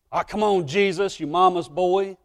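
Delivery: mock him like a schoolyard bully; mocking taunt. Kids taunting young Jesus as a mama's boy. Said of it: "Kids say".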